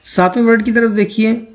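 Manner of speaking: ordinary talk, nothing marked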